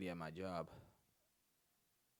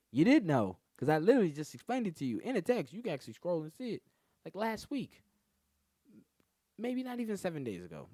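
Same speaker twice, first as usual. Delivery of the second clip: higher, louder, fed-up retort; exasperated rant; 10 semitones higher, 11 dB louder than usual. Retold or imitated. Explaining to the girl again, loudly exasperated. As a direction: hammer the point home, fed up. Arguing back in retold fight.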